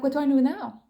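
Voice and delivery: retold panic. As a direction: re-enact helpless panic without real tears. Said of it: "panicked. I was like".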